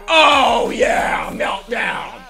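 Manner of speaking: loud, high hype call; hyped call sending in Meltdown; hyped shout. Real, not acted. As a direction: announce the pokemon like a hype man. Hyped switch-in.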